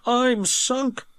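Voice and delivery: high engine voice, resigned; Thomas despairing. A narrator's reading. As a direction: admit defeat as little Thomas. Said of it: "said Thomas".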